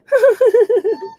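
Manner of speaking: high, loud laugh-like dog noise; imitated dog noise. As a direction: mimic a dog that almost sounds like laughing. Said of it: "she'll be like".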